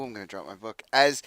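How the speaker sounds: ordinary talk, nothing marked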